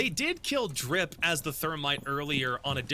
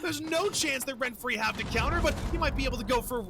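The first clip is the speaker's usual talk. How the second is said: live play-by-play; 8 semitones higher than usual. Real, not acted.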